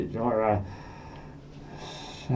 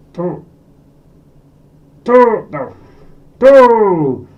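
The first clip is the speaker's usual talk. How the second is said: loud, high drawn-out "two"; loud rising countdown call; excited build; 6 semitones higher, 8 dB louder, much slower than usual. Acted. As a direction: build the suspense, then belt it.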